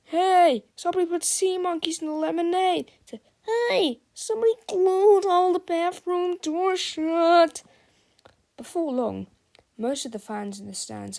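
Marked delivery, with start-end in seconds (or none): 0.0-2.9 loud high hurried complaint; shout. Acted. A fan complains about the lemonade, loud high and fast. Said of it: "people shouting everything from". 3.4-7.6 loud, high outraged call; shout. Acted. A fan complains about the bathrooms, loud high shout. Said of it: "people shouting everything from".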